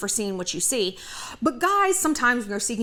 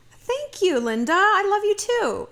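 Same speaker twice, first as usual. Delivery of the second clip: gushing friend; 9 semitones higher, slower than usual. Retold or imitated. Friend's gushing reply to mother-in-law.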